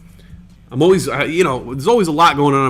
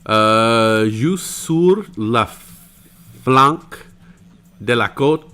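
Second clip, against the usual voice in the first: put-on reading French with an American accent; French poem reading; slower than usual. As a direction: attempt at reciting French. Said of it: "Nailed it".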